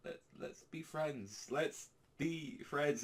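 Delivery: high, drawn-out, halting nervous plea. Retold or imitated. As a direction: imitate someone timidly stammering out an offer of friendship, slowly. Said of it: "like, really nervous".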